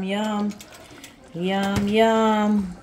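ordinary talk, nothing marked

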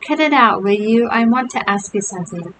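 plain reading voice

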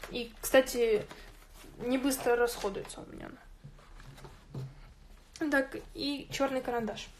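ordinary talk

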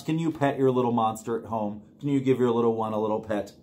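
usual speaking voice

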